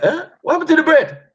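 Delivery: high, loud mock scolding mum; mock angry mum. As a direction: play the mum as she tells him off about the bread. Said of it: "My mum be mad".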